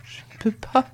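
high, soft tearful plea. A narrator's reading. Servant girl refusing her master, tearful. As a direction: repeat it weakly through tears. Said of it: "Elle se mit à pleurer et répéta".

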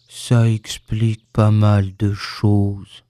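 low, slow, gloomy donkey; sombre. A narrator's reading. Donkey learning his tail is gone, sombre. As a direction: say it with heavy gloom, deep and drawn out. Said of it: "dit Ian d'un air sombre".

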